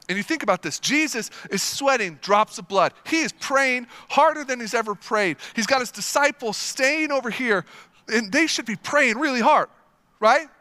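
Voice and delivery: ordinary talk, nothing marked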